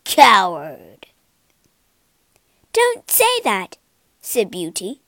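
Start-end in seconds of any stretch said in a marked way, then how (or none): 0.0-1.1 low, loud monster growl; growled insult. A narrator's reading. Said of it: "the beast growled". 2.7-3.8 high, louder indignant retort; angry protest. A narrator's reading. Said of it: "She was so angry".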